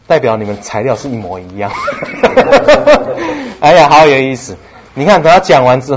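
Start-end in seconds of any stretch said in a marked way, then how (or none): 1.8-3.6 a high burst of laughter. Real, not acted. Preacher laughs with delight, high and loud.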